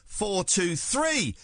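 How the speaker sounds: usual speaking voice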